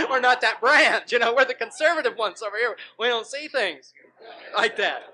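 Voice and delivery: high, loud, laughing through the words; mock your own church while laughing; laughing mockery. Real, not acted. Mocking own conservative church, laughing.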